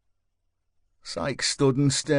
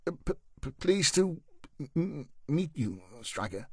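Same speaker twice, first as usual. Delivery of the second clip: quiet, halting stutter, softer; nervous stutter; 4 semitones higher, 4 dB quieter than usual. A narrator's reading. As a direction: stammer it out timidly and politely. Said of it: "stuttered the new Jack, in his quiet, cultured accent".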